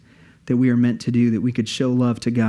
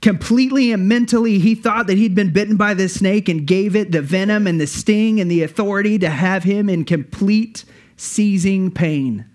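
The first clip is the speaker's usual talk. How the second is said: building excitement; 7 semitones higher than usual. Acted. Preacher builds the story's climax.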